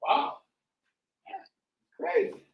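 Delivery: high, stunned exclamation; amazed. Real, not acted. Reacting to [name] beef news, stunned high disbelief.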